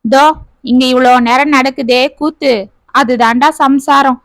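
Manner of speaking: ordinary delivery, nothing marked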